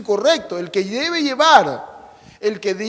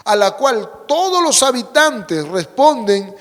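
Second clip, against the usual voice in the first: louder, emphatic sermon delivery, stretching key words; preaching at full force; 6 dB louder than usual. Acted. Preacher proclaiming to the congregation, full-force preaching. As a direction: drive each phrase home from the pulpit.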